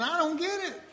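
high, mock-helpless complaint; lost and baffled. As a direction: play up not understanding. Voicing himself as lost listener.

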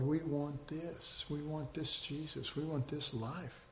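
very soft, earnest yearning; imagined believers' hushed desire; hushed longing. Acted. Voicing future believers longing for Jesus.

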